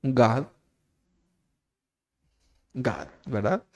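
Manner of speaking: God said the English way, as gad; preacher sounding out the English God, gad. Shown as a demonstration. Preacher teaching the congregation the English word, said as gad. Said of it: "Cómo se dice Dios en inglés".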